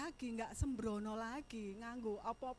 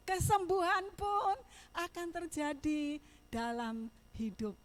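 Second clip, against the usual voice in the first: louder, slower declaration of faith; preaching; 10 dB louder, slower than usual. Acted. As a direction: testify it slowly to the church. Preacher proclaiming God's goodness. (bersaksi dengan lambat dan mantap)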